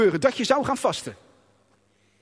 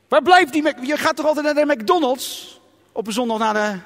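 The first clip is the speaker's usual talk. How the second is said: raised, higher nagging voice of the body; voice of the hungry body; 4 semitones higher, 3 dB louder than usual. Pretend. Preacher voicing his body demanding food. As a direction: whine for chips and McDonald's. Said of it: "Die schreeuwt".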